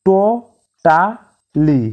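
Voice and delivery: টোটালি drawn out slowly as to-ta-li; slow teaching demo of টোটালি; syllable by syllable. Shown as a demonstration. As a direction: break টোটালি into three slow beats. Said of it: "তিনবার উচ্চারণের সাথে".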